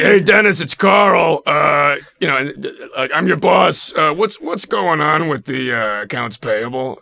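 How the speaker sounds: loud, high, slowed chummy boss; hammed-up boss voice. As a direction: play the pushy boss calling out to Dennis. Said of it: "but I would be like".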